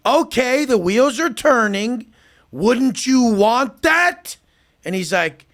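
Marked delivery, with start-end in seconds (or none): 0.1-4.4 condescending coaxing. Retold or imitated.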